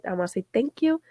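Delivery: usual speaking voice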